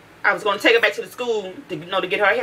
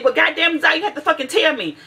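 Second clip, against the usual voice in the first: angry scolding; 7 semitones higher than usual. Retold or imitated. Retold scolding of daughter.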